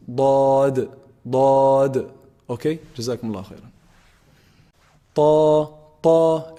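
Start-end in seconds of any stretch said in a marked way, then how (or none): 0.1-2.1 "Dad" held long and loud, tongue sides on upper molars; Arabic letter dad. Shown as a demonstration. Teacher modelling the unique Arabic letter, "Dad" drawn out heavily. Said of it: "upper molars". 5.2-6.5 loud, held "Ta" with a heavy Arabic t; heavy letter ta. Shown as a demonstration. Teacher demonstrating for students, "Ta" loud and heavy. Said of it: "It's a heavy letter".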